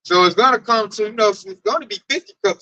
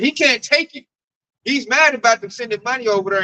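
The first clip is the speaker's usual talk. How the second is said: normal talking voice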